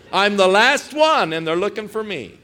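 high, loud outburst that falls off; Elijah's lament. Retold or imitated. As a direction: protest to God as the lone survivor. Elijah's complaint peaks.

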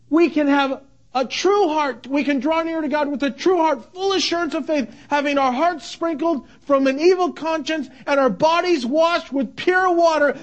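usual speaking voice

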